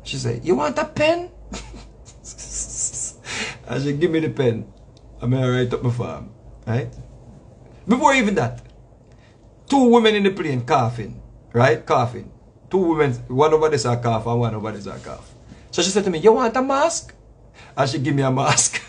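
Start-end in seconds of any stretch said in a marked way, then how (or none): 0.4-1.3 put-on high old-lady voice with a rising lilt; mimicked old lady, pitched right up; elderly lady. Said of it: "elderly lady". 16.2-17.1 put-on higher old-lady voice, rising at the end; lightly mimicked elderly lady's question. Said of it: "elderly lady".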